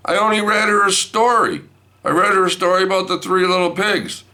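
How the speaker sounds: very high, insistent protest; defensive protest. Retold or imitated. Grandpa defending himself to wife, high-pitched protest. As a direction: plead innocence with pitch shooting up.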